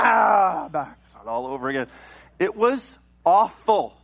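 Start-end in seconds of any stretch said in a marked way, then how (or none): 0.0-0.7 loud, high mock cry; sudden drawn-out wail; imitated baby wail. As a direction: burst out like a woken baby. Floor creak, baby wakes again.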